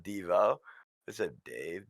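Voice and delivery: normal talking voice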